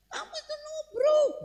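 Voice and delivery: high yell of accusation; accusing shout. Retold or imitated. Woman accusing the driver of hitting her, high yell. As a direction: cry out that he hit her.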